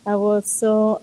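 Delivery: ordinary delivery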